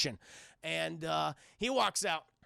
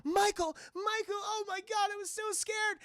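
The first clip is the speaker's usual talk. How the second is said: high-pitched damsel, relieved; Felicia Hardy; 11 semitones higher than usual. Acted. Felicia waking to her lover, high and relieved. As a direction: gush it in a high feminine put-on voice. Felicia greets Morbius, says she was scared.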